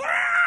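very high yelp of a dog hitting the fence; dog's yelp. Retold or imitated. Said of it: "He'd start yelling".

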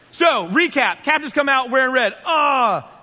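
loud, rising hype; excited recap. Acted. Recapping the game day for the crowd, excited shout. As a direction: pump the crowd up.

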